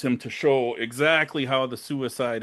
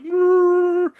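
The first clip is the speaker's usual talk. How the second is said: high, loud drawn-out noise; imitated growl; 7 dB louder than usual.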